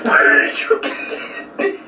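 breathy laughing question; stifled giggles. Real, not acted. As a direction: giggle while asking. Laughing repeat.

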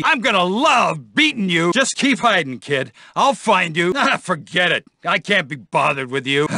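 ordinary talk

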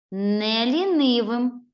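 Не лінивим slow, stress on лінивим; emphatic reading. Shown as a demonstration. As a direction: lean on лінивим. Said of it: "Прочитайте слово".

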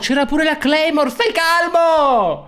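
high, exasperated protest, drawn out at the end; indignant retort. Real, not acted. Player telling his blaming teammate to calm down, indignant. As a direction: push the voice up high, dragging out the final words.

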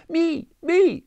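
very high, loud squeal. A narrator's reading. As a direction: squeal it like an eager muskrat. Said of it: "squealed he".

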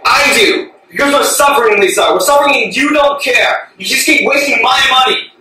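very loud, impassioned pleading rage; anguished angry shouting. Acted. Husband accusing wife of wasting money, angry shouting. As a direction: shout it at full force, desperate to be heard.

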